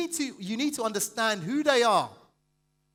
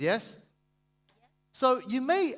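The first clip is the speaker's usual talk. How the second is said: ordinary delivery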